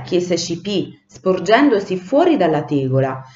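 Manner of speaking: usual speaking voice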